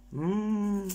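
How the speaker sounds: chewing between words; muffled closed-lip murmurs; mouth full, chewing. Real, not acted. Seen 0: Bites syrnik at -1.0, chews with closed puffed lips until ~3.8.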